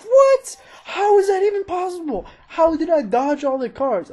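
high, loud cry of disbelief; stunned shout. Real, not acted. Gamer shocked that he dodged every car, shouting. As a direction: yelp it out, amazed.

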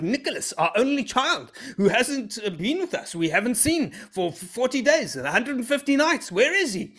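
high, hammed-up worried mother; frantic mom. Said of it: "my mom was like".

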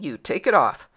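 loud, low order; forceful royal command; imperious king. A narrator's reading. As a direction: order him sternly. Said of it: "said the king".

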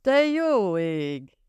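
high, slow dismayed cry; startled exclamation. A narrator's reading. Cameron remembering a dinner she promised, startled cry. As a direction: catch yourself remembering something. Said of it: "kiáltott fel Cameron".

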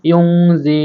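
ইউংজি with a nasal ng held; nasal hold on ইউংজি; ghunna. Shown as a demonstration. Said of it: "সেজন্য আমাদেরকে গুণনা করে পড়তে হবে।".

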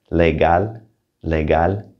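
legal in Spanish with a hard G; legal, Spanish pronunciation, G as in go. Shown as a demonstration. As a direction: say legal slowly apart, Spanish vowels and hard G. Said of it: "That's a hard G".

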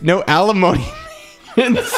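losing it mid-line; giggling through the joke; laughing. Real, not acted.

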